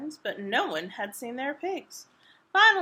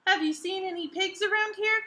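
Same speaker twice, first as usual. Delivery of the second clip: higher, calling across the road; 7 semitones higher than usual. Acted. Ethel calling to the hitchhikers, higher and calling. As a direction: call out to the strangers. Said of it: "called Ethel".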